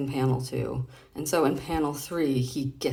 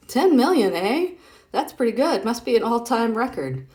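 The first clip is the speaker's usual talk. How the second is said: much higher and louder proud boast; boasting character; 7 semitones higher, 7 dB louder than usual. Acted. Reading a boast from the comic strip, high and excited. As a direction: crow about the record.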